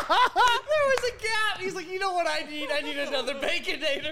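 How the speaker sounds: laughing hard. Real, not acted. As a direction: crack up while you tell it. Cracking up retelling.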